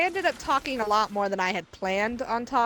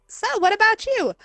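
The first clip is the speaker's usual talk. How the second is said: high-pitched mocking question; 12 semitones higher than usual. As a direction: ask it in a high, gushing mock voice. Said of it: "they go".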